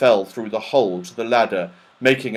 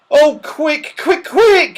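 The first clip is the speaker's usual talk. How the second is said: shout; 8 dB louder, slower than usual. Acted. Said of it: "shouted Joe".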